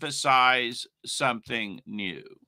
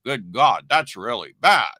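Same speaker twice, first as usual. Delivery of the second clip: mock self-righteous scolding, lower and muttered along; put-on holier-than-thou disapproval; 6 semitones lower than usual. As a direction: imitate a sanctimonious critic, pitched down. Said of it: "So don't be all self-righteous".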